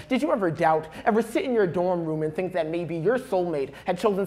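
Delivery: rising slam-poetry intensity; spoken-word poem, building. Acted. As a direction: let the poem gather momentum line by line.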